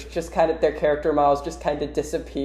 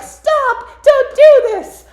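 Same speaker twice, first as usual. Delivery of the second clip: very high falsetto plea; squeaky, loud mock desperation; Amy; 10 dB louder, slower than usual. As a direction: shriek it as a cartoon girl intervening. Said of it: "Amy steps in".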